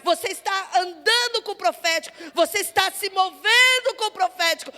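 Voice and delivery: rising from firm to loud full-force preaching; building preaching. Acted. Pastor urging the church to walk with the prophetic, building loud. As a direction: build the fire line by line.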